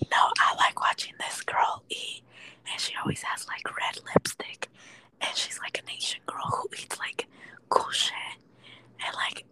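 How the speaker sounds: soft unvoiced whisper; ASMR whisper. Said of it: "Use your A S M R voice".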